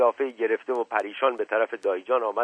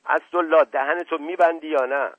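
loud, high angry shout at Asadollah; 6 semitones higher, 5 dB louder than usual. Acted. Uncle shouting at Asadollah to hold his tongue, loud and high. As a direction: yell at him to shut up. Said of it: "فریادش بلند شد".

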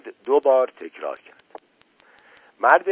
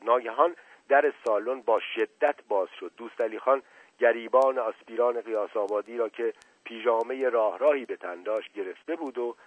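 plain reading voice

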